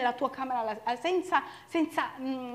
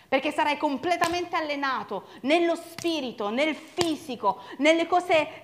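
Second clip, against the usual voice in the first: loud, driving sermon voice; fiery preaching; 4 semitones higher, 8 dB louder than usual. Acted. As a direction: preach it out at full force. Preaching at full force. (predica appassionata ad alta voce)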